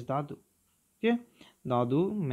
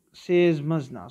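usual speaking voice

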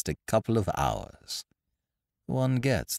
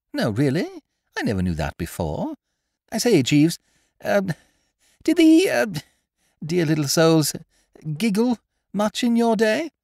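much higher, louder toff's voice; breezy young master; young gentleman; 13 semitones higher, 6 dB louder than usual. A narrator's reading. Wooster stopping his valet, in a much higher, louder voice.

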